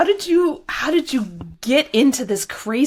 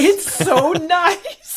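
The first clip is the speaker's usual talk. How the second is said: slow, high echo broken by a laugh; 9 semitones higher, 3 dB louder, much slower than usual. Real, not acted. Host laughing as she echoes the guest's booth praise. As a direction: laugh through the words, stretching them out.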